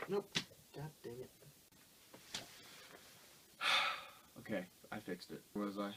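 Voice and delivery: quiet grumbling off-mic; soft, distant asides; muttering aside. Real, not acted. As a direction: grumble to yourself while fixing things. Fixing knocked-over figures.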